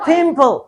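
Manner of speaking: Pimple said as फिम्पल, with F in place of P; mocking F for P. Shown as a demonstration. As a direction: swap the P of Pimple for an F. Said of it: "pimple क्या हो जाएगा जी". (पिम्पल को फिम्पल कहकर मज़ाक)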